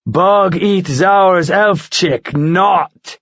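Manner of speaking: a solemn vow; grim vow. Acted. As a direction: eagle vowing to save the princess. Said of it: "he promised grimly".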